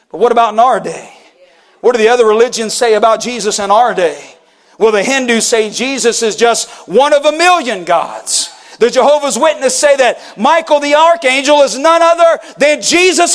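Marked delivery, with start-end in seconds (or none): none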